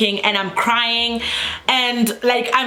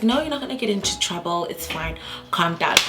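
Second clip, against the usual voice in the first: soft, reassuring retold voice; calming paramedic; 3 semitones lower, 6 dB quieter than usual. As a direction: drop softer and soothe the patient. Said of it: "this guy calms me down".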